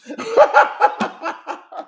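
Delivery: loud high laugh; high loud laughing fit; burst of laughter. Real, not acted. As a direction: crack up loudly. Breaks up mid-sentence.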